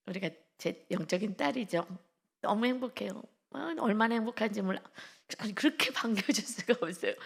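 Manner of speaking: ordinary talk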